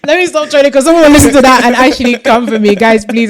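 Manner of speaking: loud, high, laughing backtrack; laughing plea. Real, not acted. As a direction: plead with listeners through real laughter. Laughs at own teasing, backs off.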